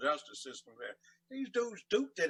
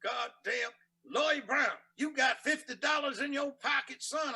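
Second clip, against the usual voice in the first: gruff yelling judge; 9 semitones higher than usual. Retold or imitated. Retold angry judge quote.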